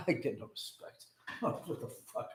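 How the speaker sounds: a hint of a put-upon old comic; Rodney Dangerfield impression. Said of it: "and Rodney Dangerfield".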